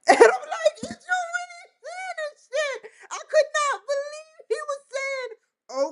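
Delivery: high, shrieking laugh through the words; laughing hard. Real, not acted. Retelling Grok's replies, she breaks into high laughter. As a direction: crack up in disbelief.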